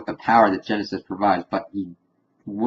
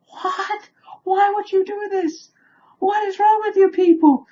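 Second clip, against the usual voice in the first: very high, squeaky exasperation; aghast disbelief. Acted. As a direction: squeal it, appalled at these people. Aghast at picnickers watching people die.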